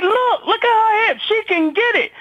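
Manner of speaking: high, slow, admiring leer; brother ogling. Retold or imitated. Brother admiring her hips on TV, high slow leer. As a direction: savour every word like a smitten guy. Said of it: "this how he said it".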